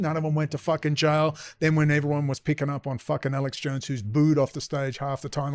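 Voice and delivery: normal talking voice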